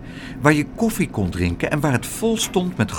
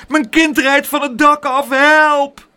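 high, loud wail of alarm; panicked scream; 12 semitones higher, 6 dB louder than usual. A narrator's reading. Aunt watching her child go off the roof, screaming. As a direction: shriek desperately for help. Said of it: "schreeuwde tante Pleeg".

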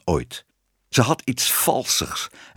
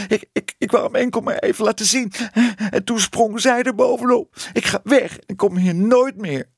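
high, stammering tearful protest, getting louder; sobbing child; 13 semitones higher, 3 dB louder than usual. A narrator's reading. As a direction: wail it indignantly through sobs. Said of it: "snikte ze". (snikkend, hoog en verontwaardigd)

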